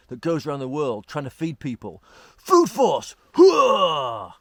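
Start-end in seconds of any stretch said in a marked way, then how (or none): 2.4-3.2 high, loud army battle cry; loud gung-ho soldier. Pretend. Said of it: "some specialist military unit". 3.3-4.3 loud military hooah; gung-ho soldier shout. Pretend. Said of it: "some specialist military unit".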